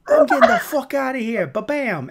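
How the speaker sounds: loud, high New York grouch impression; Grumble. Grumble walking out on everyone, loud New York accent. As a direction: storm off in a brash New York voice. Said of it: "He has this New York accent".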